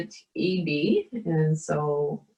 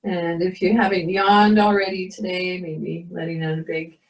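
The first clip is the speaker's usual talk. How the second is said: guided meditation; 2 semitones higher than usual. Acted. Leads a yoga meditation.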